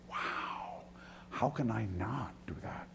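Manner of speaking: hushed, whispered wonder; awed whisper. Real, not acted. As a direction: whisper it in awe. Awed response to the cross.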